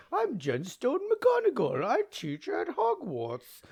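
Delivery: high, slow, loud mock professor voice; McGonagall impression. Yelling in the car as McGonagall, high and slow. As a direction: announce yourself grandly as a Hogwarts teacher. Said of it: "I'm like".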